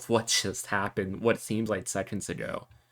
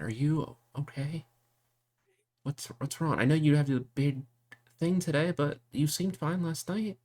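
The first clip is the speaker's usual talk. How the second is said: higher, gentle worried asking; worried questioning; 7 semitones higher than usual. Acted. Sister asking her brother what's wrong, higher and worried.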